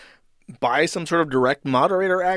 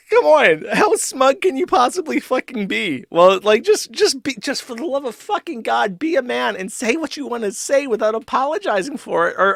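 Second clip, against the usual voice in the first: high, loud, laughing disbelief; incredulous rant; 9 semitones higher, 4 dB louder than usual. Real, not acted. Ranting to chat about the co-host's smugness, incredulous. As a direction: push it up high, half laughing, exasperated.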